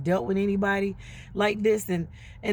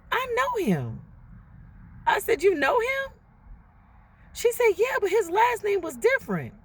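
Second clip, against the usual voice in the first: surprised recognition; 12 semitones higher than usual. Retold or imitated. Friend recognizing boyfriend.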